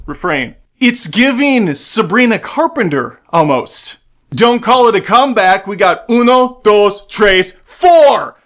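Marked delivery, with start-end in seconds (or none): 4.3-8.4 louder, higher build to a shout; hype count-in. Pretend. Counting in the Fantastic Four.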